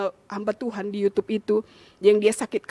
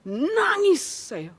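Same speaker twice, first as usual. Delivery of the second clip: suara tinggi, diulur seperti merintih; nada menangis; 6 semitones higher, much slower than usual. Retold or imitated. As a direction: kenang tangis itu dengan kata yang panjang melengking. Mengenang dirinya menangis. (merintih saat bercerita)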